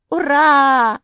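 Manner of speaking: very loud, high hurrah; jubilant cheer. A narrator's reading. Мафи jumping after catching Марсия, jubilant cheer. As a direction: cheer at full voice. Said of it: "запрыгала Мафи".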